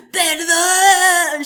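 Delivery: high, loud, drawn-out wail; mock scream. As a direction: imitate him screaming theatrically for pardon. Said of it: "he's on his own screaming".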